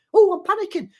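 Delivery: imitating a player's panicking brain; mock panic. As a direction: play the brain freaking out in a high squeal. Brain voiced panicking on ball.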